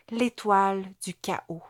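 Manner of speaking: ordinary delivery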